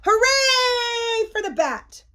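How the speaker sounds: high, drawn-out cheer; joyful shout. A narrator's reading. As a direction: cheer it out, stretching the hooray. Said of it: "shouted the witch".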